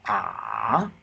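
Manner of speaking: "ah" dipping low, then rising; long dip-and-rise "ah"; third tone. Shown as a demonstration. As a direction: drop "ah" down and bring it back up. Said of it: "the third tone is".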